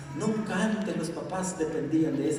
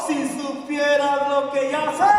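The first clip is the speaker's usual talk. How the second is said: higher and louder, triumphant and sure; triumphant shout; 12 semitones higher, 8 dB louder, slower than usual. Acted. Preacher declaring victory over the enemy, triumphant shout.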